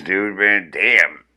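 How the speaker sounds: ordinary delivery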